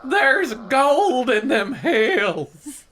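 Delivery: put-on slow, drawn-out old miner voice; a hammed-up grizzled gold digger; old prospector. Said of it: "He sounds like an old prospector".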